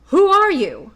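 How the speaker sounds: loud, high challenging shout; cried out. A narrator's reading. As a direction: bellow it at the little man. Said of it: "cried out".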